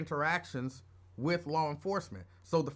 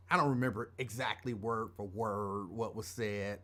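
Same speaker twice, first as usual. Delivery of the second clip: ordinary delivery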